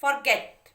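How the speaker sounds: Forget loud, leaning on गेट; teacher's emphatic forget, second syllable stressed; stress on last syllable. Shown as a demonstration. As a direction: punch the गेट of forget for the class. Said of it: "कहाँ पे आ रहा है?".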